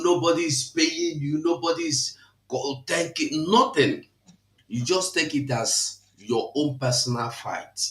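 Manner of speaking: ordinary delivery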